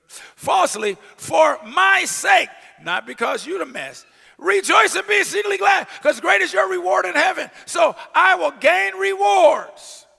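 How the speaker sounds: loud, high, half-sung preaching; preaching at full force. Acted. Preacher chanting the beatitude at the church, high and loud.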